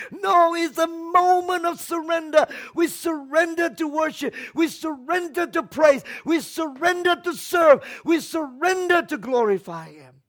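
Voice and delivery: loud, high, fervent preaching; preaching at full force. Real, not acted. Pastor urging congregation, loud fervent preaching. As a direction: drive it home with shouts.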